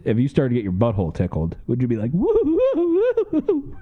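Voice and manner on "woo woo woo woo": high, drawn-out ghostly woo; excited ghost. Pretend. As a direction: hoot like a giddy ghost. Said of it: "You sound like an excited ghost".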